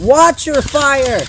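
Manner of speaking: slow, loud, high yell; shout. Real, not acted. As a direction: bellow the warning. Yelling at a player.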